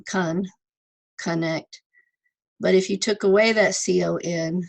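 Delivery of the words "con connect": "con" by itself, then "connect"; careful teacher breakdown of "con" in "connect"; sounding out. Shown as a demonstration. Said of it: "sound out the word".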